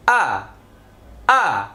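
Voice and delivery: "আ" cut short, very quickly; very quick vowel. Shown as a demonstration. Teacher showing hamza zabar's sound, "আ" said very quickly. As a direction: say a brief open "আ" without stretching it. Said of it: "খুব তাড়াতাড়ি।".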